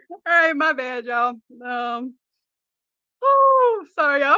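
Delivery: apologising while still laughing to tears; gasping sorry through helpless laughter; crying with laughter. Real, not acted. Apologising to viewers, crying with laughter. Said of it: "I'm really crying over here".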